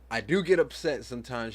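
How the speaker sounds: usual speaking voice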